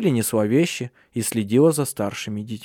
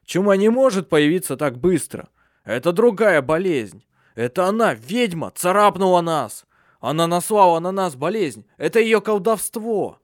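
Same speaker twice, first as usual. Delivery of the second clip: raised, urgent explanation; a hint of a fearful elder; 6 semitones higher than usual. Elder tells companions the witch cursed them, urgent raised voice. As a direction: insist it is witchcraft.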